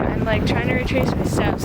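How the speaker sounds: ordinary talk, nothing marked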